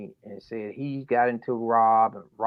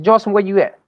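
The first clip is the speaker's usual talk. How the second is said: louder and higher, urgent; alarmed call; 7 semitones higher, 9 dB louder, faster than usual. Retold or imitated. Father to daughter on the phone, louder urgent demand. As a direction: demand an answer right now.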